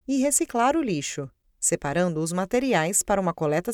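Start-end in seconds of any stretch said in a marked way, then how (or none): none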